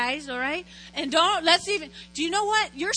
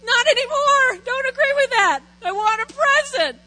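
put-on loud, higher childish demand; mock tantrum; 9 semitones higher, 11 dB louder than usual. Insisting to her husband on a present, a loud mock tantrum. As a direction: stomp your foot like a spoiled kid.

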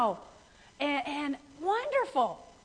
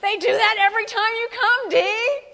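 mock self-scolding; 7 semitones higher, 7 dB louder than usual. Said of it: "But I was like".

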